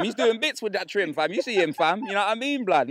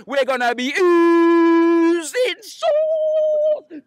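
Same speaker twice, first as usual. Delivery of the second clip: drawn-out hype; 9 semitones higher, 8 dB louder, much slower than usual. Acted. Hype boast about match.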